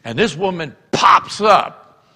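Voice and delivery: ordinary talk, nothing marked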